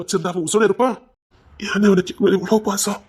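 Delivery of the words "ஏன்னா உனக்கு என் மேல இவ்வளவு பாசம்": ordinary talk, nothing marked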